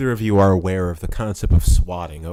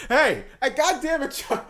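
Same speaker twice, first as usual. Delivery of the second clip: high laughing protest; laughing outcry; 13 semitones higher than usual. Real, not acted. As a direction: yell while cracking up. Friend used real name.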